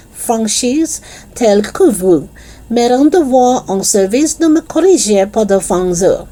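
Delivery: eager French teacher. Acted. Teacher questioning a supposed French pupil, eager and animated. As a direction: gush questions in excited French.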